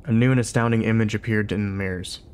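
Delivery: usual speaking voice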